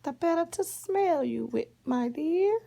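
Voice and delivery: high-pitched fake granny, lilting upward; crafty high grandma disguise; wolf posing as grandma. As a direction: answer sweetly in a put-on high voice. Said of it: "The wolf dressed up like her grandma".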